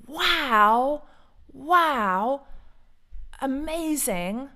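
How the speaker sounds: high, loud delighted wow; excited wow. Real, not acted. Vlogger to viewers about the rally, delighted loud wow. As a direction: gasp it out, thrilled by the news.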